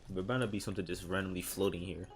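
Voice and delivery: ordinary delivery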